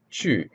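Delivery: "Chu" falling steeply from high; emphatic Mandarin falling tone on "Chu"; fourth tone. Shown as a demonstration. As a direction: start "Chu" high and let it plunge. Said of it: "fourth tone for that word".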